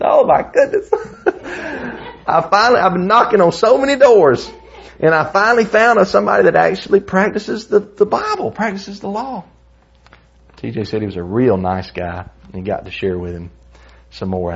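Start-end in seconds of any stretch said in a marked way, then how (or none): 0.0-9.4 high, loud imitation of an amazed visitor; astonished exclamation. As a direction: exclaim it way up high, thrilled to finally find one. Retold astonished Jehovah's Witness exclaiming.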